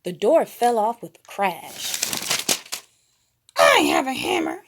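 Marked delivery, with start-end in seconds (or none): 3.6-4.6 high cartoon voice; quirky cartoon kid; Gonzo voice. A narrator's reading. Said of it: "he said".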